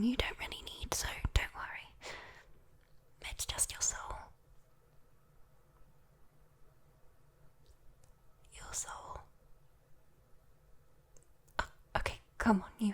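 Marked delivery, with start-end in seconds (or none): 3.3-9.2 soft, voiceless whisper; hushed reveal. Acted. Demon quietly naming the price to a human, hushed. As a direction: slip it out under the breath.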